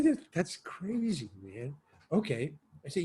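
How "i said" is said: usual speaking voice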